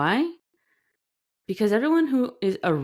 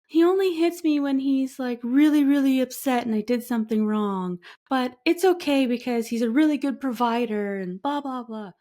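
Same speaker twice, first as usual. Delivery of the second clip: high, louder voice of someone excusing an abusive partner; mock excusing abuse; 9 semitones higher, 4 dB louder than usual. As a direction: play a partner making excuses for him, pitched up. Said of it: "you're trying to be like".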